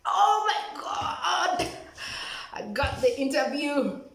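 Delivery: high, loud, drawn-out squeal of joy; overjoyed. Retold or imitated. Reliving the news of making it to interviews, overjoyed. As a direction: cry it out high and loud, stretching the words.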